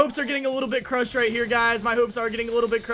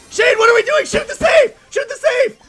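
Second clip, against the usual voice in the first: high, loud yell at a teammate; frantic shout; 14 semitones higher, 11 dB louder than usual. Real, not acted. Player to teammate who won't shoot, frantic shout. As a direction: really scream the urgent order. Yelling at teammate during play.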